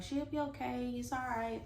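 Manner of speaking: put-on high, soft soothing voice for a crying little girl; comforting a child. Sister soothing the crying youngest daughter, high and soft. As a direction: hush her gently like a scared toddler. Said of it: "my sister was telling her".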